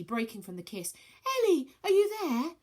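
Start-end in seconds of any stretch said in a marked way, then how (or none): 1.4-2.6 anxious calling. Acted.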